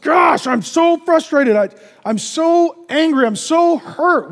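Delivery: high, slow exasperated outburst; acted venting. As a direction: pour out your frustration, drawn out. Venting to a lightning rod.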